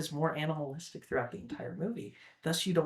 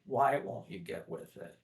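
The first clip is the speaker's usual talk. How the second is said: softer, understated pleading; retold plea; 3 semitones lower, 7 dB quieter than usual. Father in the live action imploring his son, softly acted plea. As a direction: drop the volume into a mild imitated plea. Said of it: "it's more of a plea".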